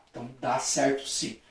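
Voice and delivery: ordinary talk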